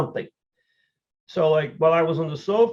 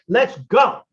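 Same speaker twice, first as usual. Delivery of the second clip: higher, louder rallying cry; pump-up shout; 7 semitones higher, 7 dB louder than usual. Retold or imitated. Racer shouting to pump himself up, higher and louder. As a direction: fire yourself up for the race. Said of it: "And I start talking".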